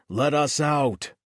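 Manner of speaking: loud, slow demand; shouting. Acted. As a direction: bang on the door and shout. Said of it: "pounding on the door".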